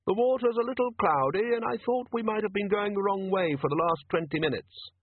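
polite tinny thing, pressing on; tinny polite machine. Acted. Device continues to Jackson, tinny and high. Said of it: "in a tinny yet polite voice".

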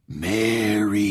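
drawn-out ghostly call; stretched, haunting summons; eerie ghost voice. A narrator's reading. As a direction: call out slowly like a spook in a closet. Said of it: "an eerie whisper murmured from the closet".

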